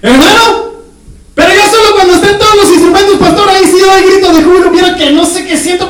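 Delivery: voz aguda y fuerte de feligrés exigente; hermano quejoso. Acted. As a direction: reclama los instrumentos en tono agudo. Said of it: "imita de nuevo al hermano".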